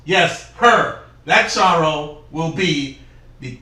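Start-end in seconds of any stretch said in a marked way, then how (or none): none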